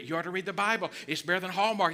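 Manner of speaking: ordinary talk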